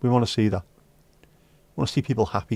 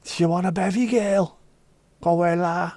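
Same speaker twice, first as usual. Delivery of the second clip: put-on Scouse accent; 6 semitones higher than usual. Mock Liverpool barmaid offering drink.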